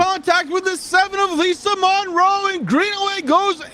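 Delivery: high shouted play-by-play; shouted race call. Real, not acted. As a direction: shout the last-lap wreck. Leader crashes on last laps.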